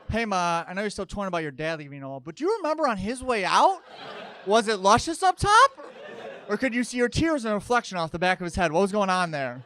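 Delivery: pry at Ma with mock sweetness; mock question to mom. Son asking his mother about her dad's hair, cheeky loud. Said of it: "imagined question to his mom".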